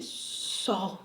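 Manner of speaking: faint, low, fearful reply; timid near-whisper, retold; trembling. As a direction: answer softly, imitating your trembling self. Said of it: "assim, tremendo".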